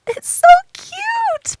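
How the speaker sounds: high squeal of delight, drawn out; cute squeal. Real, not acted. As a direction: squeal at something adorable. Squealing over a cute outfit.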